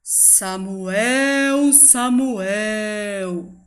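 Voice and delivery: distant, solemn calling voice; voice of God. Acted. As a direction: call out gently from afar. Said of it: "essa voz falou assim pra ele". (voz de Deus chamando)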